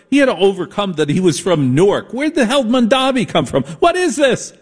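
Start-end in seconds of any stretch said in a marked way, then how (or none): 2.1-4.5 where did this guy even come from, said in disbelief; high, exasperated radio rant; incredulous outburst. Real, not acted. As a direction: pitch shoots much higher in disbelief. Host railing at unknown candidate.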